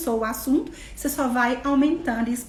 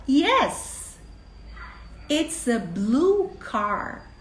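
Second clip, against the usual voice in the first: put-on slow, clear modeling English to a toddler; modeling to a child; much slower than usual. Shown as a demonstration. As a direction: lay each word out slowly for the child. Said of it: "Aí você fala".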